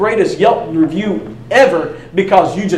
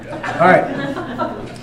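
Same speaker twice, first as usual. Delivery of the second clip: ordinary talk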